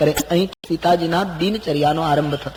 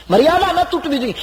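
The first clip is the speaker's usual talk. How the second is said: ન hit high and hard, preacher's punch; emphatic maxim; 11 semitones higher than usual. Real, not acted. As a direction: drive home the word ન, raised high. Preacher stresses key maxim. (ન પર ભાર મૂકીને ઊંચા સાદે)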